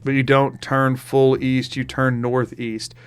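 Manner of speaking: ordinary talk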